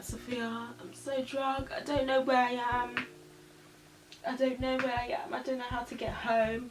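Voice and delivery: lost and helpless on the phone; mock-drunk call to her sister; drunk pleading. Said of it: "I must have been like".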